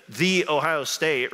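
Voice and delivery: pompous long THEE on "the"; mock emphatic article. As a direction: stretch out "the" as THEE, slowly, before Ohio State. Mocking Ohio State's emphatic "The.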